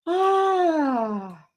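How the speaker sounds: a sudden high "Ah" of delight; excited cry. Real, not acted. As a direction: burst out with a high, thrilled cry. Reacting to a card draw.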